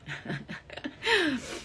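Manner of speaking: amused chuckle; real laugh. Real, not acted. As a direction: chuckle at yourself. Amused at own ignorance.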